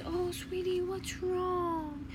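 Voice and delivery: high-pitched retold sympathy; concerned teacher. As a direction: imitate a teacher cooing over an upset pupil. Teacher voiced consoling crying pupil.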